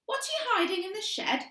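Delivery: high, gleeful little sister taunting; gloating, catching her out; accusatory glee. A narrator's reading. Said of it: "said Annabel with accusatory glee".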